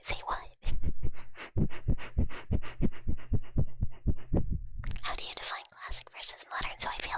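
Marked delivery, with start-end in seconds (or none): 0.4-5.0 whispered laugh. Real, not acted. Whispered laugh during chat.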